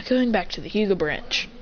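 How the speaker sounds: usual speaking voice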